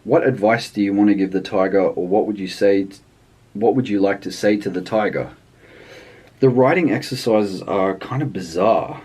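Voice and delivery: ordinary talk, nothing marked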